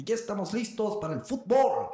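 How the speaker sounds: high mock announcer building to FÚTBOL; anuncio de árbitro. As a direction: proclaim it like an over-excited official. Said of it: "es como que". (anuncio triunfal exagerado, en tono agudo)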